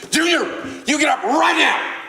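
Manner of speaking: high, loud furious yell; screaming mom. Acted. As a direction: scream the order at your child. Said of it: "now she starts yelling, screaming".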